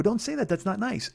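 put-on scolding girls. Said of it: "And they go".

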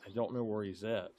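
hushed, stalking prey; whispering while hiding. Real, not acted. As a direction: keep it low so the bear won't hear. Sneaking up on a bear.

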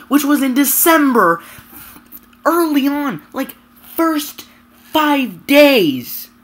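real gamer rant building to disbelief; angry rant. Real, not acted. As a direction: vent it out, fed up and loud. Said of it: "So that was my rant".

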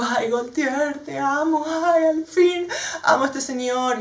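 high, slow, teary gush of love; overjoyed fan, nearly crying with delight. Retold or imitated. Gushing over Gaultier's inclusive show. (emocionada y aguda, casi llorando)

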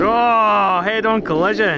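high, loud burst at the crash; surprised shout. Real, not acted. Friend shouts in surprise at the head-on crash. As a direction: yelp as the trucks collide.

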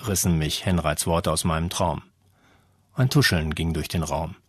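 ordinary delivery

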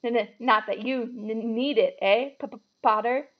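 slow, jittery stutter on N and P; stammering. A narrator's reading. Nervous professor addressing Harry, stammering. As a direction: stumble into "N-n-, not" and "P-p-Potter". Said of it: "stammered Professor Quirrell".

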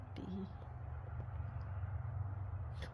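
hushed breathy chuckle; soft whispered laugh. Real, not acted. Owner laughing at her sleepy horse, hushed laugh.

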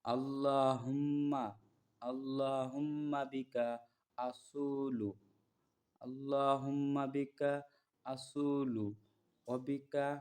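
drawn-out recitation phrase by phrase; slow Arabic recitation. Shown as a demonstration. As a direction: recite slowly so students can follow. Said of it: "দেখেন".

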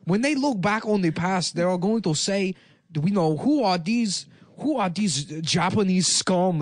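halting mock foreign-accented speech; put-on candidate voice. Candidate speaking of how history will judge, mock foreign accent. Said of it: "chooses to sound like that".

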